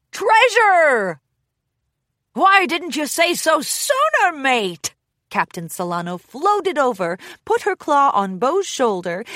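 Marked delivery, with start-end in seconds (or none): 0.1-1.2 high, loud triumphant reveal; excited. Acted. Bo spells it out. 2.3-4.9 high, loud jolly pirate; pirate captain. Acted.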